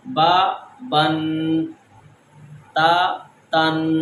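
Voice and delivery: با then بن, adding the final n; slow contrast drill. Shown as a demonstration. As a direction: slowly set با against بن. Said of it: "فرق کرتے ہیں زبر اور double زبر میں".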